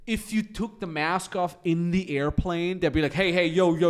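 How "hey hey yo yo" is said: scolding call-out. Retold or imitated. Flight staff scolding mask removal.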